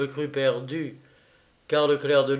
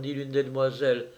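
plain reading voice

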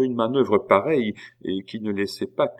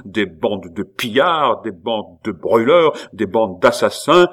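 building accusation, each item louder; indignant outcry; 7 dB louder than usual. A narrator's reading. Abbé denounces Pitou's men, louder with each charge. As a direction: pile up the charges with mounting outrage. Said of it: "s'écria l'abbé".

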